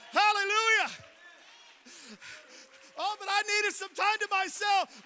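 loud, high preacher's cry; preaching at full force. Acted. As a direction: lift the church with a ringing shout. Preaching climax.

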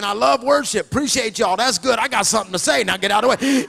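loud, eager shout to get the mic; a caged lion pushing to speak; impatient shout. Retold or imitated. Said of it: "I'm saying".

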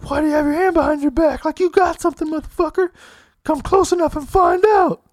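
high, fast squeaky threat; imagined stranger threatening, squeaky mockery. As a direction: parody a bluffing hillbilly. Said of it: "could you imagine someone's like".

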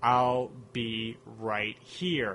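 very slow, creaky alien whisper-voice; a slow alien E T line. Retold or imitated. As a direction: croak it tenderly like E T. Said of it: "E T says the immortal lines".

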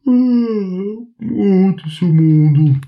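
sleepy dog waking, stretching and yawning. Acted. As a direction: yawn the words out while stretching. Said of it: "en s'étirant et en bâillant".

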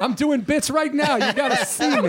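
mock-frantic, laughing high voice; frantic showing-off. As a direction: play someone desperate to be watched mid-pratfall. Acts her frantic phone-cord bit.

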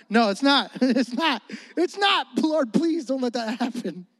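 laughing denial. Real, not acted. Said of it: "laughing denial".

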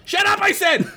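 high shouted yell. Acted. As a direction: yell it into the dark. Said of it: "Sid yelled into the void".

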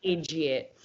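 playful Irish-style accent; put-on Irish-style accent. Shown as a demonstration. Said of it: "favorite fucking thing to say in an accent".

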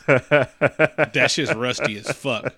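ordinary talk, nothing marked

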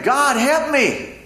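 high, slow, pleading cry for help; desperate prayer. Retold or imitated. Man hanging from a cliff pleads to God, high and slow. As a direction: beg God slowly from a cliff edge. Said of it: "And he's praying".

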